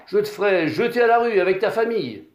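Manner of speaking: loud, fast, menacing landowner; threatening. A narrator's reading. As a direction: bark the threat at the tenant, pushing fast. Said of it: "Le père Prosper l'a menacé".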